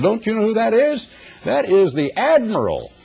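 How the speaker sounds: scolding commander. Retold or imitated.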